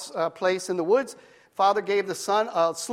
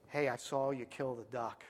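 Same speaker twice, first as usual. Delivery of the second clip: soft, sly sister voice, keeping it from the grandparents; sly quiet taunt; 5 semitones lower, 14 dB quieter than usual. Acted. Sister quietly taunts her brother at the table, sly and hushed. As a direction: hush it like a sneaky sister.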